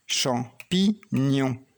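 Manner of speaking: champignon drawn out very slowly, syllable by syllable; slow syllable drill. Shown as a demonstration. Teacher to child finding the sound, very slow champignon. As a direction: stretch champignon so the child hears the ni. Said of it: "Où est le son ni?".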